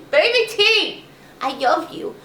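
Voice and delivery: high, loud call to the puppy; puppy talk. Real, not acted. Owner breaks off to call her puppy, high baby talk. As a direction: sing out to the little dog.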